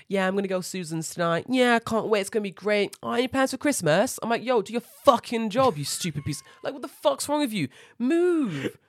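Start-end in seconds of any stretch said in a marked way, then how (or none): 0.1-4.2 high-pitched mock small talk; chatty staff. Mocking two gate staff chatting in the corner, high and chirpy. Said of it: "just having a chat". 4.4-8.8 high, exasperated mock tirade; upset rant. Retelling a rant at chatting gate staff, upset and high-pitched. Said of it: "I was so upset".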